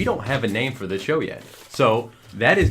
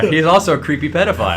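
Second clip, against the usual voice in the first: laughing; 7 semitones higher, 6 dB louder, faster than usual. Real, not acted. Joking over the laughter.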